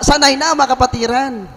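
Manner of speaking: usual speaking voice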